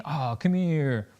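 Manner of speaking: slow, soft, drawn-out coo; soft and soothing; tender comforting. Acted. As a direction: comfort a hurt little kid, slowly. Comforting a little girl who fell.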